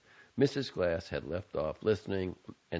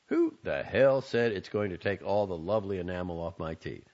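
put-on irritated young man; 4 dB louder than usual. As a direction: toss her words back irritably. Son throws her words back.

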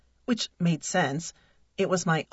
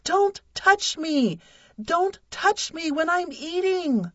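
shouting; 10 semitones higher, slower than usual. Acted. Wife screaming at husband not to touch her while eating. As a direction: scream each word at him. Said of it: "I shouted".

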